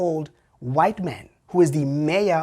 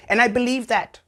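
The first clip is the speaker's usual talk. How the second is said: ordinary talk, nothing marked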